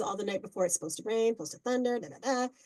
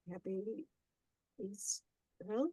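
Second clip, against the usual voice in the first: much softer, puzzled Happy Easter; confused sister; 10 dB quieter than usual. Retold or imitated. Sister puzzled by an Easter greeting, soft and confused. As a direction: mumble it as a baffled sister.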